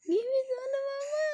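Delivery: delighted high-pitched coo; high squeal. Acted. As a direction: squeal it up high to get the baby going. Coaxing baby, laughing.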